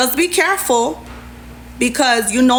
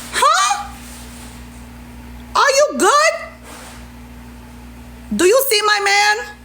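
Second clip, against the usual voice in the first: incredulous shock; 10 semitones higher than usual. Real, not acted. Reacting to being told she wanted Max, high stunned disbelief.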